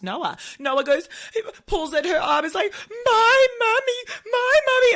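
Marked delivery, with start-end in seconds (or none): 3.0-4.9 put-on high, loud wailing little boy voice; crying toddler. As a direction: wail like a toddler clinging to a stranger.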